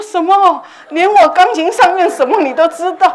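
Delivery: high, loud startled disbelief; shocked outcry. Retold or imitated. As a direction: cry out, amazed. 惊讶鬼知钢琴. (对女鬼高声惊叫)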